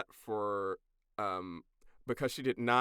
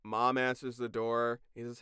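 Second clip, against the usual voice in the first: ordinary talk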